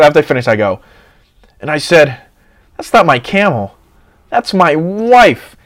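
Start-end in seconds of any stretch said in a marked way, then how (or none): 2.8-5.6 a loud, high punchline, slowing down at the end; joke line. Acted. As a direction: deliver the punchline big. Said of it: "I needed something funny to say".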